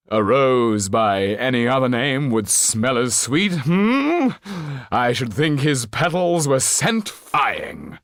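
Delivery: put-on theatrical old actor. Actor Trippin telling the banker about the boy, theatrical old actor. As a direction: declaim like a hammy actor. Trippin quoting, higher slow.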